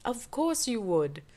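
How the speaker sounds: normal talking voice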